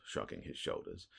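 ordinary delivery

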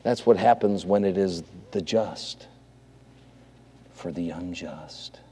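low, soft, falling to near whisper; solemn hushed preaching. Real, not acted. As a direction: reverent hush with a long pause. Preacher drops to solemn hush.

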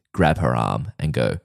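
ordinary talk, nothing marked

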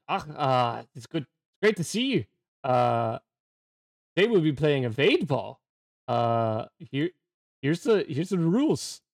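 put-on vaguely German dwarf accent, lilting up; game master's dwarf accent, vaguely German. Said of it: "You guys like my dwarf accent?".

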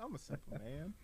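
lightly voiced country farmer; a hint of a simple farmer; old farmer. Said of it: "Why do you sound like a farmer?".

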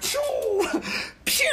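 high laughing pew sounds; zipping away like a cartoon; mock zoom-off. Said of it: "And I'm like".

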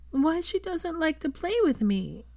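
put-on high, slow, tearful little-girl voice. A narrator's reading. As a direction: ask like a small child near tears. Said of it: "Rose asked tearfully".

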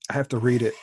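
ordinary talk

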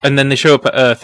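normal talking voice

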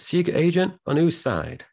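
hammed-up outraged question; J W Pepper. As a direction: pitch shooting up in mock disbelief. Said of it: "J W goes".

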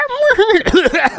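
high shrieking ghoul laugh; Crypt Keeper cackle. Acted. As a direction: cackle like a horror host. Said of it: "Crypt Keeper voice".

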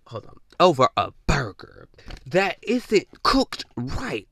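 usual speaking voice

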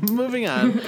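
co-host laughs as they agree to move on, laughing. Real, not acted. Laughing agreement to change topic.

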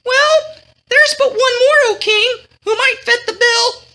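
high-pitched courtier addressing his king; voice of Wayland. A narrator's reading. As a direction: play a squeaky-high royal servant. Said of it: "Wayland said".

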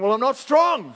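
protesting objection. Acted. Congregation member objects to being called strong, protesting.

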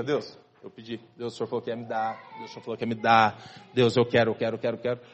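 lamúria baixinha de criança mimada imitada; criança mimada pedindo. Retold or imitated. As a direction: choramingar como criança mimada pedindo a Deus. Crente mimado pedindo a Deus. (imitando criança mimada, em tom mais grave e baixo)

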